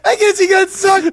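laughing high. Real, not acted. Still laughing at the story.

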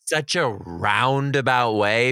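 slowed, drawn-out words; slow drawl. Real, not acted. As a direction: stretch it out deliberately. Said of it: "describing Southern talk".

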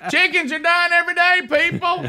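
high, far put-on voice; quoted catchphrase. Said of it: "as the guy said".

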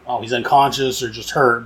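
ordinary talk, nothing marked

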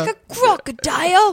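loud, high Aussie crocodile hunter; exaggerated Aussie accent; Australian accent. Shown as a demonstration. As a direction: put on a big, high Australian twang. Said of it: "like an Australian accent".

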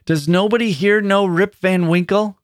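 put-on old man lost among strangers crying out in despair. A narrator's reading. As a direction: cry out lost and hopeless. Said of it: "cried out in despair".

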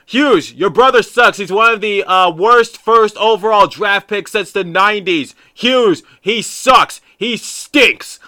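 loud, high heckling of the player; trash-talk yelling. Retold or imitated. Fan heckling Luke Hughes through the glass, loud and high. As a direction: taunt him across the ice, at full voice. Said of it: "I'm just yelling from the top of my lungs".

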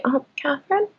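softly voiced stranger asking after someone; faint overheard call. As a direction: imitate a faint voice from across the room.

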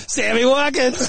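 drawn-out, high-pitched name call; retold excited cry. Reenacting a press box reaction to a big catch, excited cry. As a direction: stretch the name high, imitating a celebration.

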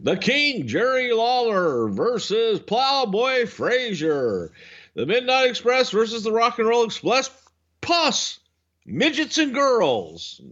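mock wrestling-card billing, drawn out; announcer reading the card. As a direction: call out the card like an old-time announcer. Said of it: "the card used to read".

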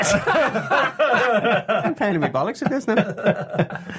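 laughing. Real, not acted. Still giggling, can't stop.

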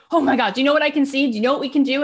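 high and fast, gushing; thrilled rush. Retold or imitated. Angela gushing to Kathy about what they can do, high and fast. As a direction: blurt out the big vision.

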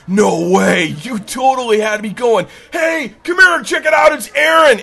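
loud, high surfer-bro disbelief; imitated excited dude yelling; amazed, calling out. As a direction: holler for friends to come see. Guy amazed, calls others over.

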